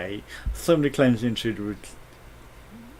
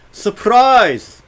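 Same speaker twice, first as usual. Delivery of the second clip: loud surprise; 10 semitones higher, 11 dB louder than usual. Acted. Robot voice surprise joke.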